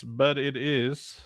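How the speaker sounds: ordinary talk, nothing marked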